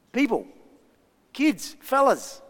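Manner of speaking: lightly acted call for a crowd's attention.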